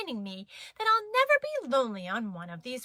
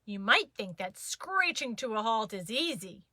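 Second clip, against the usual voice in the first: plain reading voice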